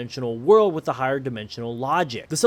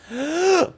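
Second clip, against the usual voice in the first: quick gasp for air; sharp gasp; 11 semitones higher than usual. Real, not acted. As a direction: gasp mid-count. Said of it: "I'm out of breath".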